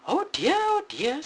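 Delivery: high, loud squeak; Mama Bear squeaking in dismay; squeaky Mama Bear. Acted. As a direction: squeak it out in alarm. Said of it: "squeaked Mama Bear".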